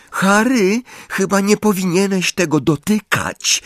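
put-on high, worried girl's voice. A narrator's reading. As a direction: warn him anxiously, high. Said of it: "powiedziała Hermiona".

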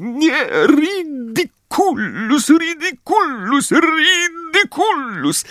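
frantic mother losing control; high desperate spellcasting climbing upward; rising panic. Acted. Molly casts in rising panic.

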